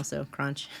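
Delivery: chewing cereal while talking; eating cereal. Real, not acted. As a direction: mouth full, quiet crunching. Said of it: "I'm eating cereal now".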